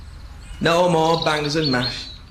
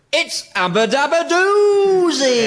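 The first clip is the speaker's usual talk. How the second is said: catchphrase; 9 semitones higher, 3 dB louder, slower than usual. Acted. Catchphrase proclaimed.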